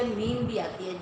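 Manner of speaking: ordinary delivery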